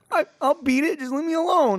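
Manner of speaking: high, whiny exasperated protest; retold yelling. Gamer pleading with girlfriend to leave, exasperated high yell. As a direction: act out a frustrated gamer wanting solitude. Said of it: "It's weird to hear him yell".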